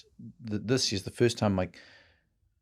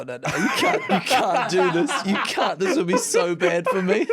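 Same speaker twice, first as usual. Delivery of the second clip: higher, louder, begging through laughter; laughing pleading; 10 semitones higher, 5 dB louder than usual. Retold or imitated. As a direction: plead like a mortified teen. Son begging dad not to.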